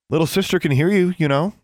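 high, loud indignant retort; offended little-sister comeback. A narrator's reading. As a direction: pipe up, pitched way high and loud. Sister indignantly answers an insult.

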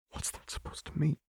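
fearful whisper. Acted. Seraphine whispers a wary question to Renata. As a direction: breathe it warily.

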